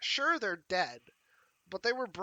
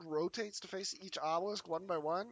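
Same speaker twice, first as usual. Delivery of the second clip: ordinary talk, nothing marked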